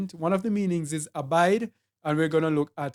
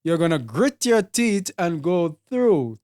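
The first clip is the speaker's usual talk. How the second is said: usual speaking voice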